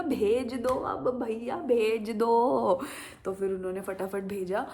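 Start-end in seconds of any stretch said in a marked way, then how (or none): 0.0-2.8 higher, louder, very slow stretched plea; eager plea. Retold or imitated. Telling the cake shop to send the cakes, pleading. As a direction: beg eagerly, drawing it out. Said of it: "तो मैंने कहा".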